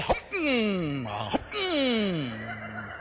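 short hoot swallowed into a sliding hum; imitated struggle to hold in a whoop; whoop being stifled. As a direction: clamp down on a holler, humming. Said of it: "and he got it to there".

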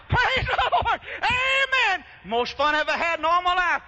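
very high, loud revival shouting; imitated shouting worshipper at full pitch; ecstatic hollering. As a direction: let it all go in shrieked praise. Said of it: "while he was hollering".

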